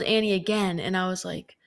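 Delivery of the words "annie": ordinary talk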